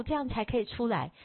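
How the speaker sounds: ordinary talk, nothing marked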